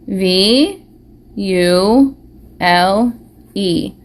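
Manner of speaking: letter by letter, slow and separate; spelling out letters. Shown as a demonstration. Teacher spelling the made-up word letter by letter. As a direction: spell each letter clearly for the kids. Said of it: "the V".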